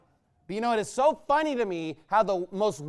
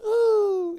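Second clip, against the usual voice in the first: high drawn-out "ooh"; teasing, scandalized high ooh; mock-shocked ooh; 12 semitones higher, 5 dB louder than usual. Mock shock at translators cussing.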